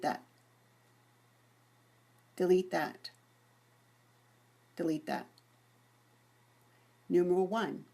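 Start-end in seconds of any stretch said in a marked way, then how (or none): none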